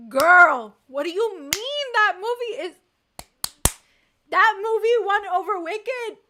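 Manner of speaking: incredulous loud rant; outraged disbelief. Real, not acted. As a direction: yell it in disbelief at the chat.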